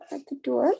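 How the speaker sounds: ordinary delivery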